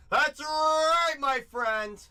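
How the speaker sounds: belting announcer cry; hype shout. Acted. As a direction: crow it like a showman. Hype reply to a viewer.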